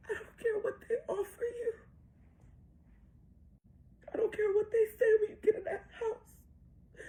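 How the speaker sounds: high, tearful, halting plea; real crying. Real, not acted. Mother begs daughter before she enters the house, crying. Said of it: "I'm crying".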